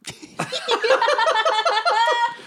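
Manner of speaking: high burst of laughter; laughing. Real, not acted. Laughing after casting.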